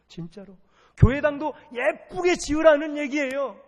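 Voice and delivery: loud, high, exhorting sermon voice; full-force preaching. Real, not acted. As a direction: drive each exhortation home from the pulpit.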